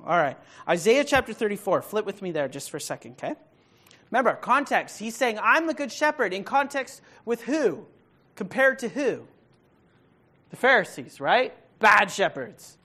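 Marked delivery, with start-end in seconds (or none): 4.1-12.8 rising fervor. Real, not acted. Pastor drives the point home.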